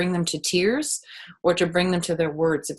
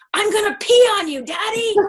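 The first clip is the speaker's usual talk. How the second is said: put-on high, loud little kid taunt; cheeky child; 13 semitones higher, 6 dB louder than usual. Kid threatens to pee on his dad, high and cheeky. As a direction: squeal it like a defiant four-year-old. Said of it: "looked at him and said".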